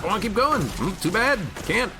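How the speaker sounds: ordinary delivery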